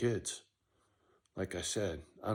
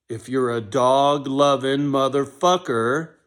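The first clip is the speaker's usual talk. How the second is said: much louder and higher, slow and drawn out; emphatic slow shout; 5 semitones higher, 14 dB louder, slower than usual. Acted. To would-be misfits, slow rallying shout. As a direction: belt it out like a rallying call. Repeats line, second time bigger.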